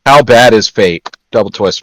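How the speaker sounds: usual speaking voice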